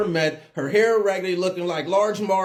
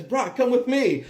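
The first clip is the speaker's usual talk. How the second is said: urgent neighbor voice; 8 semitones higher, slower than usual. Retold or imitated. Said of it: "she says".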